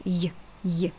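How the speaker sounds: y said as a short soft glide; quick y glide; short y sound demo. Shown as a demonstration. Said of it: "short sound".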